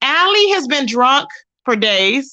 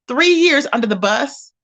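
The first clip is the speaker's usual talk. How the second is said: normal talking voice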